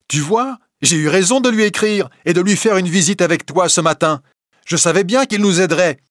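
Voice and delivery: high, loud triumphant cry; shouting. Acted. Wife shouting to husband that she was right.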